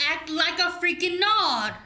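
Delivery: loud, exasperated shout; angry yell. Acted. The sister yells at the dancer to act like a Nard. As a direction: bark the order at her.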